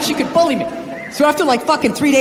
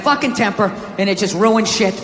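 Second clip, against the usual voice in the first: ordinary talk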